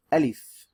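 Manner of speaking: Alif said loud and clear, long open first a; Arabic letter name. Shown as a demonstration. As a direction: model the letter name Alif for students to repeat. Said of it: "Repeat after me".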